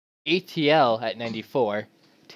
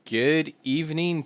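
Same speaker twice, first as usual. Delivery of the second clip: normal talking voice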